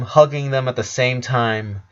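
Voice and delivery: ordinary delivery, nothing marked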